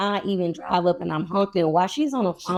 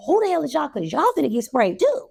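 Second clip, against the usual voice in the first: high, challenging mock anger; retold threat; 7 semitones higher than usual. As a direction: take on the newcomers, pitched high. Acted threat to newcomers.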